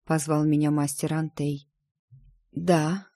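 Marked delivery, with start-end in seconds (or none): 2.5-3.1 lightly hoarse short answer. A narrator's reading. Shaken seer answering the master, hoarse. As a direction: answer in a slightly husky voice. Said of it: "какой у меня хриплый голос".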